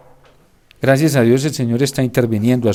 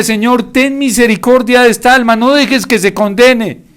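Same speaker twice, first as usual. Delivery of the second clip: tearful plea; 8 semitones higher, 5 dB louder than usual. Acted. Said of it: "lloraba y le decía".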